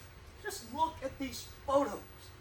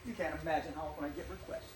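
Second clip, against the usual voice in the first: ordinary delivery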